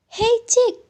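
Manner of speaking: high, loud call; calling to the chick. Acted. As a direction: hail the chick across the forest. Prince begs the chick.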